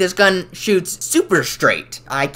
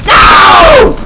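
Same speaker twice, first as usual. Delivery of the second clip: very high loud scream; 12 dB louder than usual. Real, not acted. Gamer spawn killed again, screaming no. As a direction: shriek at dying again.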